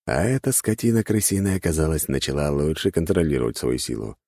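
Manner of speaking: ordinary delivery, nothing marked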